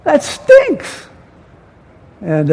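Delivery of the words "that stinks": higher, exasperated mother scolding; mock-scold like a fed-up mom; upset mother. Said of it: "my mother would get so upset and say".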